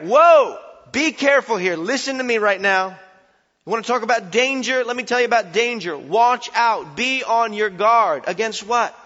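loud, high urgent preaching; fiery sermon warning; full-force preaching. Acted. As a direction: hammer the warning home from the pulpit.

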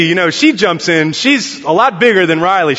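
normal talking voice

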